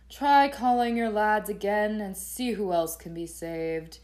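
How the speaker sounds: slow, loud haughty instruction; pompous, drawn-out delivery; arrogant Ivan. Acted. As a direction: issue orders with lofty condescension. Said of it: "with the arrogant talk the whole time".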